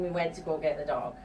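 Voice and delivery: ordinary talk, nothing marked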